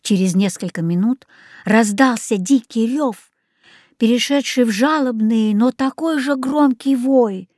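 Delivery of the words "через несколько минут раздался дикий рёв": ordinary delivery, nothing marked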